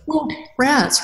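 normal talking voice

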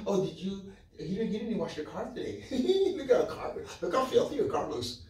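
high, mocking whine through laughter; mock nagging. Preacher mocking a trivial complaint, high laughing nag. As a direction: ham up a petty nag about a dirty car, laughing.

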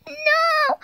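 usual speaking voice

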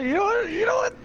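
rising to a squeak; squeaky high voice. Acted.

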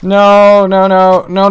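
rising panicked wail; despairing shout. Real, not acted. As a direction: yell in panic as it goes wrong. Losing fight to trident enemy.

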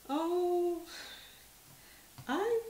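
sudden high surprised gasp of words; startled oh. Real, not acted. Vlogger spotting a forgotten piece, startled and high. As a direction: jump up high as if caught off guard. Finds something unexpected.

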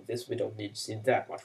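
normal talking voice

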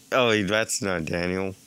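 usual speaking voice

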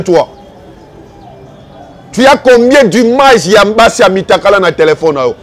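usual speaking voice

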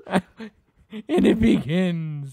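slow, high, laughing drawl. Real, not acted. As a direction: stretch it out through laughter. Repeats line laughing slowly.

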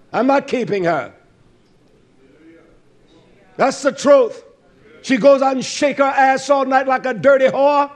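high, loud, driving preacher's delivery; fired-up preaching. Real, not acted. Preacher rebuking the congregation for excusing wickedness, fired-up preaching. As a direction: preach it hard, punching the key words.

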